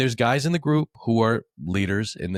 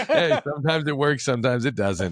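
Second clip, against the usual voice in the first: laughing; 7 semitones higher than usual. Real, not acted. Host laughing off mustache jokes with co-host. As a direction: shrug it off while still laughing.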